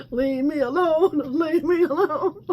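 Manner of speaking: amused dismissal through laughter; laughing leave me alone. Real, not acted. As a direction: laugh them away. Laughing shoo-off of messagers.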